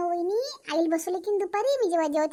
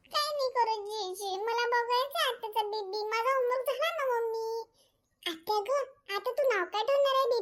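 put-on child's voice; 5 semitones higher, slower than usual. Daughter pleads with her mother, slow and high like a child. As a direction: whine sweetly like a small girl.

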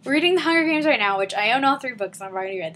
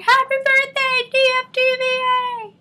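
imitating cheering friends; 12 semitones higher, 3 dB louder than usual. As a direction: chant it high like excited friends. Acts out friends' birthday greeting.

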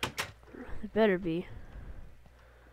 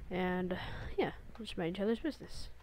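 whispering; 4 semitones lower, 10 dB quieter than usual. Real, not acted.

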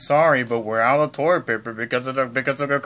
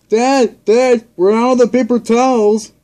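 higher, louder calling out for dad; 7 semitones higher, 10 dB louder than usual. Acted. Child voicing Echo shouting for dad about paper towels. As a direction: yell across the house for dad. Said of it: "when Echo says".

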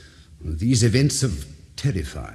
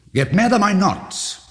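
higher and louder, pressing hard; protesting sanity, raised and pressed; defiant insistence; 10 semitones higher, 4 dB louder than usual. Acted. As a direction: insist you are not mad.

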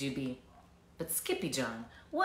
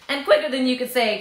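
usual speaking voice

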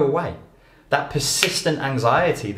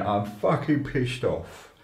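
mock angry; 3 semitones higher, 4 dB quieter, much slower than usual. Shown as a demonstration. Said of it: "instead of going".

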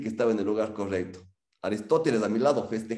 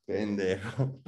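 affectionate insult; 4 dB quieter, much slower than usual. Retold or imitated. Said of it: "me acordé de mi papá".

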